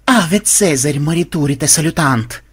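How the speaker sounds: ordinary delivery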